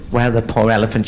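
ordinary talk, nothing marked

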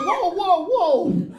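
high, alarmed calming whoas; a cashier halting a panicked customer, sharply high. Retold or imitated. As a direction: hold him back with a high whoa. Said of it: "He's like".

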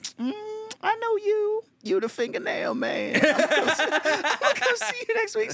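high squeaky imitation of a nail-salon customer; high mock lady voice. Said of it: "She's like".

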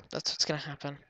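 normal talking voice

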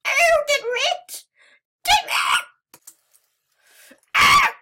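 very high, loud excited screams; high-pitched shrieks. Real, not acted. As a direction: scream it at the top of your voice. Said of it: "that's hurting my voice".

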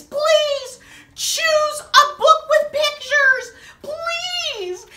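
high, loud, slow begging; desperate plea. Acted. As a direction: beg dramatically. Pleading for picture book.

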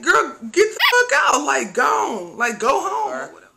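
high, loud panicked yell; half-shouting her out the door; retold shout. Telling the girlfriend to leave before mom explodes, high loud yell.

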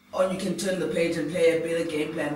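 usual speaking voice

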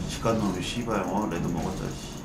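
cursing under breath. Real, not acted. Quiet grumbling after fight.

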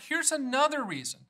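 usual speaking voice

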